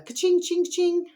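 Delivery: high sung ka-ching of a cash register; playful cash register ching; till noise. Retold or imitated. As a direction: ring it out like a till. Imitates a till ringing.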